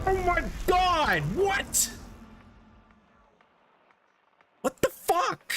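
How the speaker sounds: shrill-high squeals of disbelief, slow; high-pitched rage. Real, not acted. Gamer getting pre-fired by an enemy, high-pitched raging squeals. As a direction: screech your outrage way up high.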